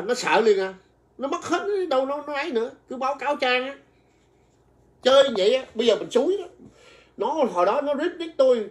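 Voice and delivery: urging viewers to mass-report with excitement; excited rallying. Real, not acted. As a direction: whip the viewers into action.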